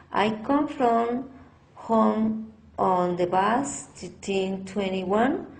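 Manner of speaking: ordinary delivery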